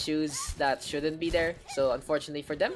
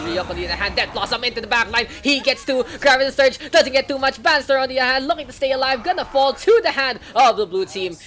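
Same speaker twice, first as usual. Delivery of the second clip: loud, higher fight call; hyped casting; 8 semitones higher, 9 dB louder than usual. Acted. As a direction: shout the teamfight as it unfolds. Teamfight play-by-play call.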